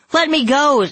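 very high, loud plea; piercing high appeal; begging. A narrator's reading. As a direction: beg desperately as a captive. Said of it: "Scarlet begged".

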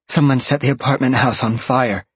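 out of breath, urgent; gasping words after running; panting. Acted. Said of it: "Roxy was panting hard".

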